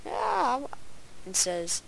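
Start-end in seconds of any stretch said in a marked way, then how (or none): 0.1-0.6 short high-pitched mock yell. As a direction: let out a playacted cry. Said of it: "starts yelling".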